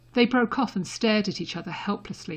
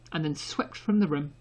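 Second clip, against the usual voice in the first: usual speaking voice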